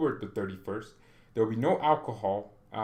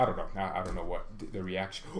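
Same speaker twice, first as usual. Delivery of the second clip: usual speaking voice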